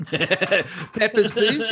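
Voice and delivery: high, hammed-up German accent. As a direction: play a German border guard. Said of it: "In a German accent".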